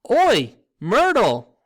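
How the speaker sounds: long, high, very loud call; bellow. A narrator's reading. Poltergeist calls across to the girl ghost, bellowing. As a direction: bellow it out, stretching each word. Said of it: "took a deep breath and bellowed".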